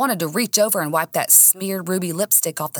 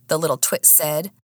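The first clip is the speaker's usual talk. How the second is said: usual speaking voice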